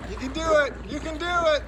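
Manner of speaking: loud high encouragement; cheering. Real, not acted. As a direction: cheer them on loudly. Cheering jumper on.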